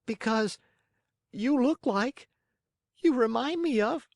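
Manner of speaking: put-on very high puppet boy, halting between phrases; stammering Pinocchio. A narrator's reading. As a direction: break off and restart in a high voice. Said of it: "stammering and stuttering".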